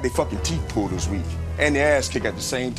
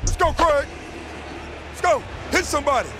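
sideline yell; 10 semitones higher than usual. Real, not acted. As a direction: fire him up from the sideline. Coach yelling from sideline.

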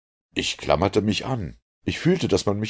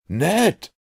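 high-pitched cry of recognition; calling out; 12 semitones higher, 4 dB louder than usual. A narrator's reading. Narrator recognises the Canadian by moonlight, calling out. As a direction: call the name out in surprise. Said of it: "rief ich".

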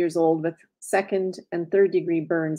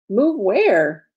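louder, swooping incredulous question; alarmed question; 3 semitones higher, 5 dB louder than usual. A narrator's reading. Cecile hears villagers must move, alarmed questioning. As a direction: demand an answer, both words leaping up.

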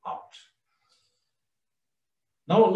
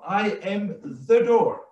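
weight on "the" as the one and only; stress on THE; 3 semitones higher than usual. Shown as a demonstration. Preacher applying the same emphasis to the door, stress on "the". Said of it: "the same is valid".

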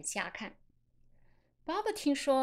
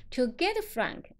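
"To get Frank" said slowly with weight; stressed phrase; 3 semitones higher, slower than usual. Shown as a demonstration. Teacher marking what must be stressed, emphatic "get Frank". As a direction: lean firmly on "get Frank". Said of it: "这个要重读".

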